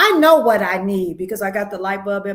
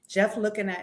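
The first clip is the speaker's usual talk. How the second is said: usual speaking voice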